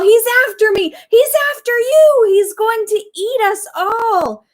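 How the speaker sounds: very high, loud panicked shriek. A narrator's reading. Dentist climbing the wall in panic, shrieking. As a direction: screech in terror up the wall. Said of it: "shrieked and started climbing".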